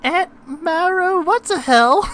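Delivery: put-on very high, slow, innocent little girl; a tiny girl asking innocently.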